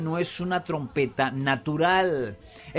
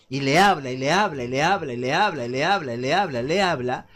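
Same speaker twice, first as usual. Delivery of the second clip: low, looping singsong repetition, over and over; monotonous repetition; 4 semitones lower than usual. Acted. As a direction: drone it on like endless chatter. Mimics someone talking on and on. (repetición monótona y machacona)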